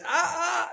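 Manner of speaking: two high, quick mock yelps; imitated pained yelps. Film character hurting his back while dancing, imitated high yelps. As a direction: mimic crying out as the back goes. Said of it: "he goes".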